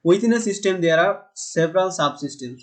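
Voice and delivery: measured classroom read of the quote; slow definition reading. Shown as a demonstration. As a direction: let each word land for the notes. Reads English definition aloud.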